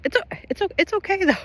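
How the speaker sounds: very high, squeaky self-soothing; tiny high-pitched voice; high squeak. Real, not acted. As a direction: squeak it out while trying to recover from laughing. Squeaky self-soothing amid laughing fit.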